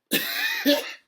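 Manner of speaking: high-pitched helpless laugh; crying with laughter. Real, not acted. Reacting to an unfinished episode, high laugh to the point of tears. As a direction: laugh till you are in tears. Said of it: "And I'm crying".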